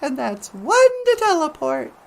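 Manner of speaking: very high, squeaky greeting; high cartoon voice. Greeting the host, squeaky and very high. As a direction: chirp it like a little puppet.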